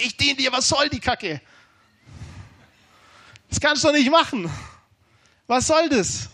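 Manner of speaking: re-enacted protest, pitched high; retold angry yelling. Preacher re-enacts screaming at God during his child's birth, angry yelling. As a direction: yell the complaint up at God, half-contained. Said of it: "ich hab ihn angeschrien und gesagt".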